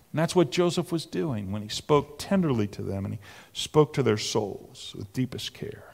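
fading to a soft whisper; tender whisper. Acted. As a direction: let it sink to an intimate hush. Describing tender speech softly.

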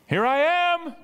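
very high, loud call; very emphatic. Acted. Preacher as Jesus calling at the door, very emphatic. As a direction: call it out high to those inside. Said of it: "he's very emphatic".

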